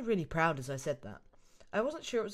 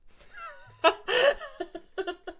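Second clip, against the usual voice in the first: high giggle; 6 dB louder than usual. Real, not acted. Laugh before voicing a line.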